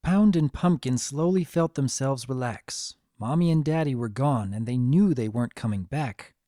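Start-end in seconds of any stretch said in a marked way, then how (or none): none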